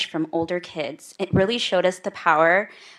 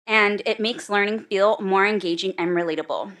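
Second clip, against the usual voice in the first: ordinary talk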